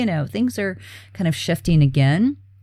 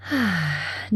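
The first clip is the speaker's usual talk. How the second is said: demo sigh; 6 dB quieter than usual. Shown as a demonstration. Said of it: "deep, full breath".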